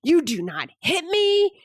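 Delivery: slow, high, loud angry yell; a mom yelling at her son for hitting her. Retold or imitated. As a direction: lay down the rule, loud and furious. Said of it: "I lost it and yelled at him".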